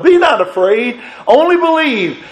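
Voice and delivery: the comfort verse flung upward in fervent preaching; preached high. Pretend. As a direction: lift "only believe" up high like a rallying cry. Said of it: "and I go like".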